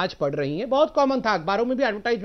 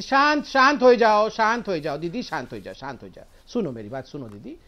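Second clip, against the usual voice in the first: mock calming; 6 semitones lower than usual. Mock-soothing an angry panelist. (चिढ़ाते हुए शांत करना, धीरे-धीरे बहुत धीमा)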